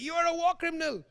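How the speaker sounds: high-pitched mock yell at the president; mock heckling yell. Activist heckling Obama in a meeting, high mock yell. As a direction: shout the accusation up high, half-playful. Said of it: "she was yelling at Obama".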